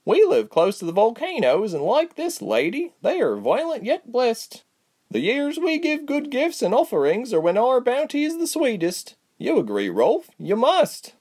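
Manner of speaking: fervent believer pressing her husband; Pia's voice. A narrator's reading. Pia urging her husband Rolf to agree, high and fervent.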